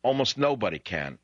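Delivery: usual speaking voice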